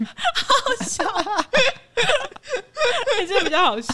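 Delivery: high-pitched laughing fit; cracking up, words squeezed out between laughs; helpless laughter, pitched high. Real, not acted. Reacting to friend's story.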